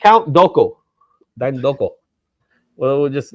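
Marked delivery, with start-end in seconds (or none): 0.0-1.9 mock-Spanish take on the name; playful Spanish accent. Host repeating co-host's reminder, playful Spanish accent. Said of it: "we're gonna do a Spanish dialect".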